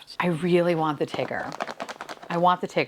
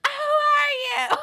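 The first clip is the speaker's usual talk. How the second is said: shocked shriek; slower than usual. Real, not acted. Shocked reaction to unboxed figure.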